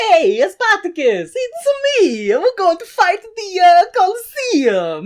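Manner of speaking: very high, loud cartoon Italian accent; hammed-up Mario-style Italian accent; terrible Italian accent. Spartacus going to fight, in cartoon Italian accent. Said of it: "if they all sounded like Mario and Luigi".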